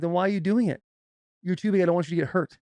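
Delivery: worked-up retold dad lecture; fired-up dad. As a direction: scold a child who ignored you. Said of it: "So now I'm fired up".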